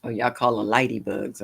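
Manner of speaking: ordinary talk, nothing marked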